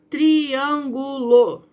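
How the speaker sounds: "triângulo" said very slowly, with a clear break between the i and the a; slow teaching split of "triângulo"; split into syllables. Shown as a demonstration. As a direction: pull "triângulo" apart, tri and ângulo as separate beats. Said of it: "o I e o A separou do triângulo".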